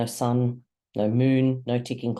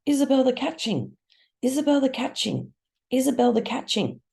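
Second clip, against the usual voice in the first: screaming; 13 semitones higher than usual. A narrator's reading. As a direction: shriek the name over and over. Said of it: "Crow is screaming".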